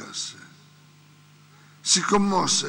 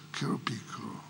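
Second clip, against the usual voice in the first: tender hush; 8 semitones lower, 10 dB quieter, faster than usual. Real, not acted. Preacher remembering his mother, tender whisper. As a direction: let it fall to a whisper.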